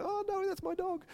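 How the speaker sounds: high alarmed wail of a dog owner; dismayed. Pretend. Owner seeing his dog bite kids, alarmed high wail. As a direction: panic as your dog bites a kid. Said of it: "and you're going".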